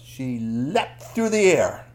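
"leapt" stretched out long, line at half speed; slow storytelling emphasis on "leapt"; dramatic leap. A narrator's reading. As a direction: draw out "leapt" as if sailing.

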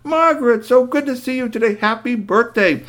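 high, smooth greeting; gracious Doctor. Acted. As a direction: charm the shopkeeper. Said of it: "calmly stepped up and said".

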